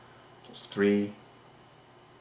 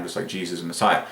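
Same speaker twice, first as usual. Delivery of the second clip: usual speaking voice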